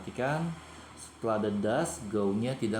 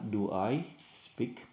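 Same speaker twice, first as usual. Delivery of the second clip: slow, deliberate English question; slow model question; much slower than usual. Shown as a demonstration. Teacher shows pupils the moved verb, said slowly. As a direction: spell out the question slowly for the class. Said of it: "do-nya dikedepankan".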